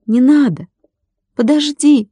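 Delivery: high, desperate call to wait; crying out. A narrator's reading. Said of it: "крикнул Владик". (отчаянный высокий крик)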